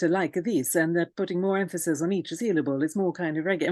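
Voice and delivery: Italian accent with evenly weighted syllables; terrible Italian accent. Shown as a demonstration. Teacher showing how Italians space syllables, badly faked Italian accent. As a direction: put on a rough Italian accent, stressing each syllable. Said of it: "I mean, that's terrible Italian accent".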